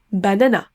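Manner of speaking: "banana" with three identical full a's; French speaker's pronunciation. Retold or imitated. Teacher imitates a francophone learner, French-style vowels. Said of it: "prononcer les trois A de la même façon".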